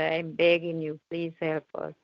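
ordinary delivery

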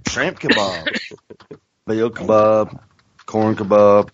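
low mock Southern food list; Bubba Gump impression. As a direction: list dishes in a low imitation voice. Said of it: "She's all Bubba Gumped".

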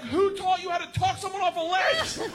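high, exasperated holler; indignant yell. Retold or imitated. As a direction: shout back in disbelief over the engines. Yelling back over the engines.

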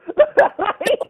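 high, loud laugh; giddy laugh; burst of laughter. Real, not acted. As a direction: laugh it off after demanding applause. Laughs after telling viewers to clap.

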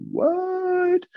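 high, stunned what; surprised what. Retold or imitated. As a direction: react in disbelief. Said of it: "I'm like".

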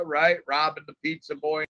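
ordinary delivery

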